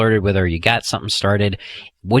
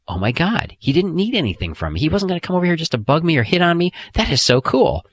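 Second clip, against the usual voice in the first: excited, higher, faster; impressed woman's thoughts; 9 semitones higher than usual. Retold or imitated. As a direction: voice her delighted surprise lightly. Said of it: "feeling of".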